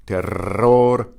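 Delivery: terror with a long rolled rr and short trilled ending; Spanish terror, both R sounds trilled; double and final R. Shown as a demonstration. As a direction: roll the middle of terror, flip the last R. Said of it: "both the double and the final R".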